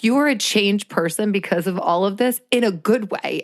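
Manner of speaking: usual speaking voice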